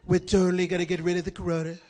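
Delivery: low, bold president; Donald Trump. Acted. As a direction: repeat yourself with low, self-assured bluster. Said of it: "President Trump was very bold".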